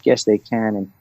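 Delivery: ordinary talk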